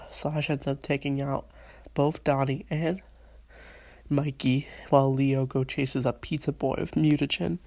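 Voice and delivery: ordinary delivery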